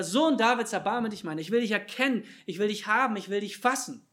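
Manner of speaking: loud, high, urgent plea; insistent calling out. Acted. Beggars keep calling to Jesus despite the crowd, insistent loud cry. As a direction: keep crying out, refusing to be silenced. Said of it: "riefen sie immer noch weiter".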